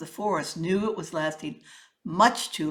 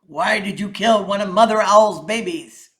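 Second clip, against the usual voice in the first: King Lion; 9 dB louder than usual. A narrator's reading. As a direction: boom it out like a king addressing his court. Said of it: "said the king".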